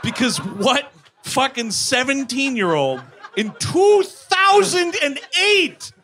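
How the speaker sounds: high-pitched, outraged disbelief; incredulous rant. Real, not acted. Comic ranting at an outdated plot point, incredulous.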